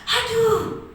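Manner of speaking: high, delighted falling "aduh"; gleeful, as if handed a present; happy "aduh". Shown as a demonstration. Coach demonstrates the same word as joy, happily delighted. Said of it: "gembira, senang, dapat hadiah mungkin".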